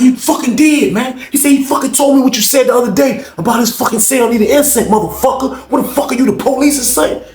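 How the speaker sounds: high, heated accusation; James accusing. Retold or imitated. James accusing Rob of snitching, high heated voice. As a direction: jab each word at him.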